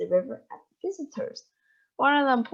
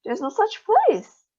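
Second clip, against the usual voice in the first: high, slow sharp objection; indignant; 12 semitones higher, 5 dB louder than usual. A narrator's reading. Mrs Salt challenging Wonka, indignant. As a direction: flatly contradict him. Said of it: "said Missus Salt".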